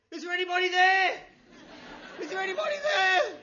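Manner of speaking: high, very loud desperate yell; calling out for someone. Acted. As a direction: yell out for anyone else to help. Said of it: "And the guy says".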